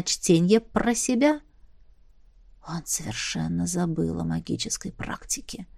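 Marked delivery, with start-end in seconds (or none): 2.7-5.7 a soft secretive whisper. A narrator's reading. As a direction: breathe it under your breath. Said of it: "прошептал мне Джейме".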